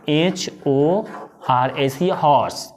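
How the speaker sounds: "हॉर्स" spelt out, then said low and soft with the h breathed; spelling horse. Shown as a demonstration. Teacher spelling and saying "हॉर्स" low and soft. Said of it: "इसको क्या बोलते हैं".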